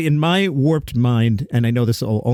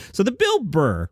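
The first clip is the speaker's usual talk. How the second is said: ordinary delivery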